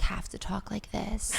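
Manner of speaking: slow, hushed whisper; whispered voiceover. Shown as a demonstration. Recording beside a sleeping ex, whispering. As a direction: whisper so a sleeper won't wake. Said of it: "my ex-boyfriend was sleeping".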